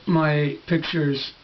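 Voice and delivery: ordinary delivery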